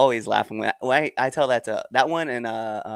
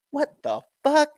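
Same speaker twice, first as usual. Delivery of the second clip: high, slow, incredulous outburst; exasperated disbelief; slower than usual. Retold or imitated. Reacting to Southerners calling her Northern, exasperated. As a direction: stretch it out in mock bafflement.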